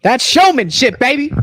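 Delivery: big showy brag; hyped showman. Acted. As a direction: sell it like a showman. Hyping himself to the chat.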